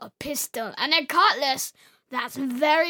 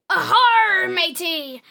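pirate voice; 3 semitones higher, 7 dB louder than usual. Retold or imitated. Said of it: "They say stuff like".

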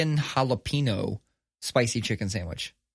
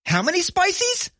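high, slow put-on manager voice asking; imitating manager; slower than usual. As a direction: mimic the manager's odd word, drawn out. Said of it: "He calls them spices".

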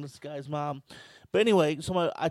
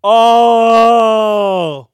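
a long, very loud, higher Oh!; 6 semitones higher, 19 dB louder than usual. Real, not acted. Friend howling at a podcast roast, long and very loud. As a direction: roar it out at the burn.

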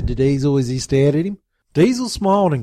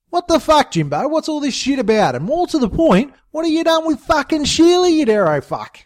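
furious demand; 11 semitones higher than usual. Acted. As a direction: rage at him for answers. Dingo furious at Jimbo.

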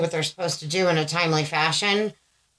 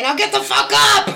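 much higher, loud yell through laughter; mock harsh yell; 14 semitones higher, 5 dB louder than usual. Mom jokes the sweet greeting turns into a harsh yell. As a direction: snap from sweet to furious, laughing.

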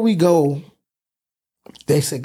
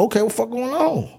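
shocked; 8 semitones higher than usual. Retold or imitated. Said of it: "I'm like".

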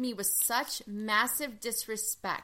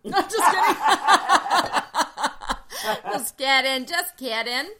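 loud, high laughter through the words; laughing hard; 5 semitones higher, 11 dB louder, slower than usual. Real, not acted. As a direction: burst out laughing while taking it back. Laughing off her own boast.

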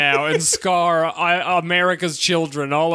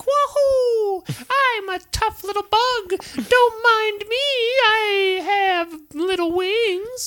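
high, tiny squeaky elf voice; chirpy little bug creature, drawn out; slower than usual. Retold or imitated. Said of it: "a little elf voice".

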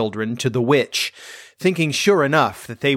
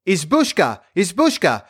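put-on higher, childlike call; childish calling out; 5 semitones higher than usual. A narrator's reading. Two orphans calling to the witch's hut, childish call. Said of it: "With their shrill, childish voices, they called out loud".

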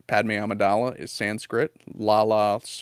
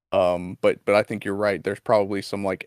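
normal talking voice

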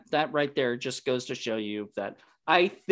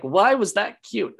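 mildly indignant retold question, pitched higher; mock challenge; 6 semitones higher, 4 dB louder than usual. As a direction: imitate critics demanding a reason. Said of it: "I get yelled at for it".

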